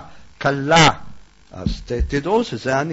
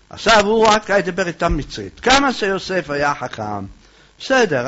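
ordinary delivery

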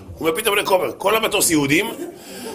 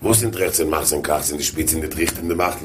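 low, quiet Yiddish grumble; offended grumbling; 10 semitones lower, 3 dB quieter than usual. Retold or imitated. Offended rabbi protesting at being left out, low grumbling. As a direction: imitate an affronted rabbi grumbling under his breath. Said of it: "ואז הרב נפגע".

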